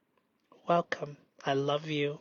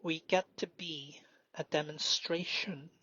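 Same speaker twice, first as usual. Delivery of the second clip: whisper; 9 dB quieter than usual. Real, not acted. Whispered self-talk.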